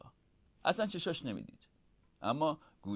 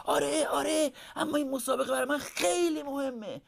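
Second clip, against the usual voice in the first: put-on high, raised child voice; high-pitched, louder insistence; slightly louder protest; 11 semitones higher, 3 dB louder than usual. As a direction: raise your voice to insist, like a young owl. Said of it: "با صدای کمی بلندتر گفت".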